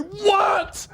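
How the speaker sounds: high, strained mock yell; retold high-pitched cry; exasperated retort. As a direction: yell back in hammed-up exasperation. Acting out his answer to the call.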